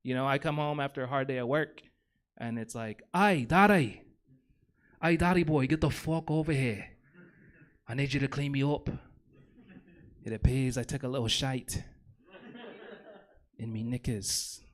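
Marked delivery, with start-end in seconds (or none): 3.1-14.6 put-on a toddler doing a rough Irish brogue; bad Irish accent. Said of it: "sounds like Conor McGregor".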